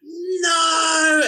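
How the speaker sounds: high, drawn-out screamed refusal; imitated toddler scream. Toddler rejecting a parent's help, imitated scream. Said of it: "she just screams and screams".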